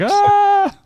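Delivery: high, loud retold yelp of fright; sudden loud "ah!" shooting way up; high-pitched scream. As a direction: scream like a priest bolting from a ghost. Priest flees screaming in story.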